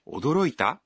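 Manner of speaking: 驚いた lifting in pitch at the end as a question; rising question. Acted. Kenta asks Anna if she is surprised, rising question. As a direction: ask 驚いた with a rise on the last sound. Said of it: "with a rising intonation".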